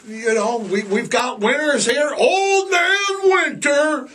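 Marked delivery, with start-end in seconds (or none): 2.2-4.1 booming announcement. Acted. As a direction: announce him like a circus ringmaster. Announcing winter like a showman.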